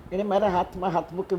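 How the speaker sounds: usual speaking voice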